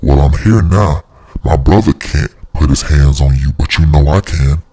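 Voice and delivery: low-pitched, controlled threatening voice; menacing sister. Retold or imitated. Sister confronts brother's girlfriend, low menacing voice.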